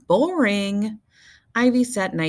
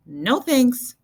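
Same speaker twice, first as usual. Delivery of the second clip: high, loud breezy refusal; Bean refusing; 8 semitones higher, 5 dB louder than usual. A narrator's reading. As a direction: brush it off brightly. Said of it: "she said".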